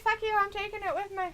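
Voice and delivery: quick, indignant imitation; mock defiance. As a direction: act out a defiant parting shot. Voicing wrestler's defiant exit.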